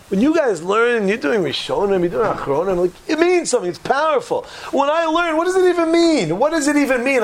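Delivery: high-pitched, pleading lament; exasperated self-doubt. Acted. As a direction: wail about how little you understand, pitch climbing. Acted lament of an overwhelmed learner.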